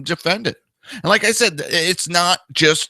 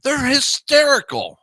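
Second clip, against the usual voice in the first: mocking; 14 semitones higher than usual. Calls Never Trumpers hysterical.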